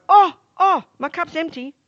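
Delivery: high, startled "oh, oh" that drops on the cup; mock dismay at finding the cup empty; surprised exclamation.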